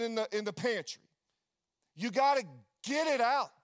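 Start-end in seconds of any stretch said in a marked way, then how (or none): 2.0-3.5 loud, pushing exhortation; emphatic preaching. Acted. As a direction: drive it home hard.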